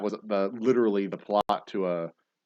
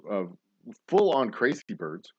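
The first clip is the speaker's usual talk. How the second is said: ordinary talk, nothing marked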